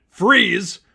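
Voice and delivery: loud, high barked order; police yelling freeze at inmates; shouted command. A narrator's reading. Officers shouting at inmates to freeze, loud command. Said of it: "Gordon and his men commanded".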